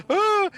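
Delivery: high short mock scream of fright; startled child scream; startled kid scream. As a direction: yelp like a scared kid. Startled by mom in kitchen.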